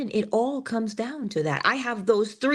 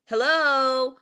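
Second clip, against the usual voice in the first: impatient hello; 5 semitones higher, 3 dB louder than usual. Retold or imitated. Cashier prompting silent customer.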